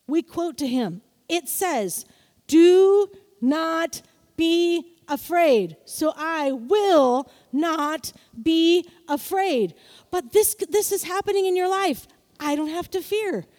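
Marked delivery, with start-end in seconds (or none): 2.5-9.7 slow, loud, every word weighted; slow scripture declaration. Shown as a demonstration. Preacher quoting scripture to the congregation, slow and loud. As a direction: proclaim it word by word. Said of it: "quoting scripture slowly".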